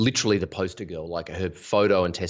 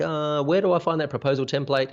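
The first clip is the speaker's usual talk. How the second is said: higher-pitched imitation of a puzzled newcomer; lost newbie; 8 semitones higher than usual. Newbie asking mentors for help, imitated lost beginner. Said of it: "when the newbies come into the program and they're like".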